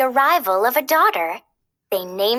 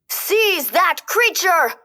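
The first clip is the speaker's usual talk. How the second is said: high, slow commanding cry; king cries out; 6 semitones higher, slower than usual. Acted. King Stefan orders guards to seize the fairy, crying out. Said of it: "King Stefan cried out".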